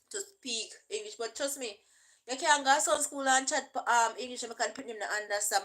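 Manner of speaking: normal talking voice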